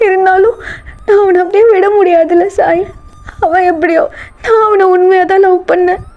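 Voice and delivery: high, tearful pleading; acted crying. Girl confessing her love to her friend, crying. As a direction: push the words out through sobs. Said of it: "இப்ப நீ ஏன் அலற".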